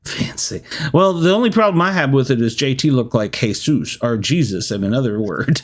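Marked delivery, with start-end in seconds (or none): 0.0-0.6 whispered echo. Real, not acted.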